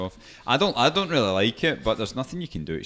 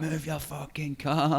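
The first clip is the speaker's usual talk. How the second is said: slow, higher mock angry driver; imitating angry driver; 6 semitones higher, slower than usual. Driver blocked in by her, a mocking angry-driver voice. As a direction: drag out the angry driver's demand. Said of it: "this guy's like".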